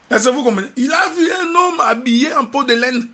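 fervent preaching. Acted. Preacher telling the church about John the Baptist, fervent preaching. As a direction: proclaim it to the congregation, building to a shout.

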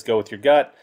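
usual speaking voice

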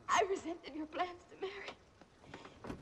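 normal talking voice

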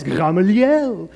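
slow, hammed-up French "Gamaliel"; French accent. Shown as a demonstration. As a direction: draw the name out like a Frenchman, ga-ma-LYEL. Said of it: "If you're from France".

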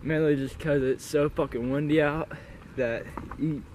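ordinary talk

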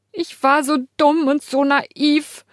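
high, slow, sob-choked; sobbing. Acted. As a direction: sob it into the blanket. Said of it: "schluchze ich in diese Decke".

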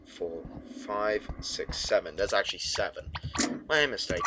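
usual speaking voice

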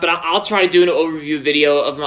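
ordinary delivery

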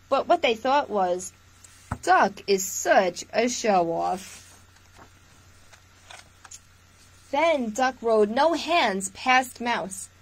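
lively storytime read-aloud; picture-book voice for little listeners; reading to children. Acted. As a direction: read it warmly to a room of kids. Reads picture book to viewers.

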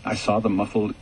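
plain reading voice

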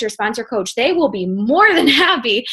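ordinary talk

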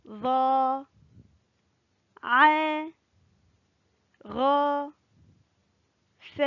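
each letter sounded as a single open-a syllable, long pauses between; letter drill with fatha. Shown as a demonstration. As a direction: model each letter sound evenly for pupils to repeat. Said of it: "هيا رددوا خلفي". (نطق الحروف بالفتحة مقطعًا مقطعًا مع وقفات)

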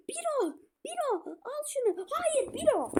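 high calls turning to a groan; urgent urging. Real, not acted. Gamer urging Biro then despairing at the miss, high calls. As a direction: call him then cry no.

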